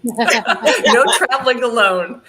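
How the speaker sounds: real laugh breaking through the line; amused, laughing at the husband's reply. Real, not acted.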